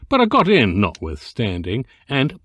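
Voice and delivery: ordinary delivery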